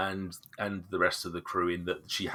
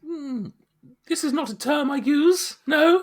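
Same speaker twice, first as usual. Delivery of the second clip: high haughty NPC; 7 dB louder than usual. Acted. NPC offended by "love.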